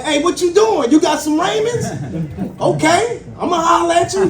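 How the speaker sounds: high, laughing mock yard shout; imitating yelling inmate. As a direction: inmate calling out from his cell, high and laughing. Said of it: "You like".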